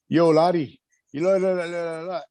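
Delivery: garbled, high Welsh accent imitation; bad Welsh accent. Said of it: "he had the worst Welsh accent".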